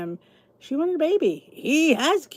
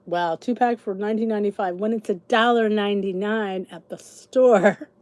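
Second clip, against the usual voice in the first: ordinary talk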